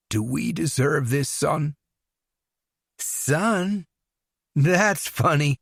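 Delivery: lightly voiced unhinged son. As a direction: toy with the parents calmly. Dwight's unhinged replies.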